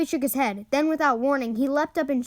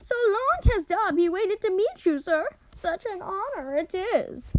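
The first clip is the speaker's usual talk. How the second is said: high eager elf voice; high and earnest, like a tiny servant; house elf; 7 semitones higher, slower than usual. A narrator's reading. Dobby's elf voice.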